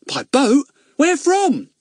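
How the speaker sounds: put-on high, loud startled boy. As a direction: jolt in surprise.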